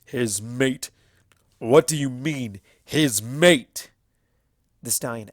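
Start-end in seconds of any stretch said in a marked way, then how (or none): 0.0-3.9 louder, stallion's voice bristling with disbelief; confused and angry, pressing the question; confused anger. A narrator's reading. Stallion demanding an answer, confused and angry. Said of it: "split evenly between confusion and anger".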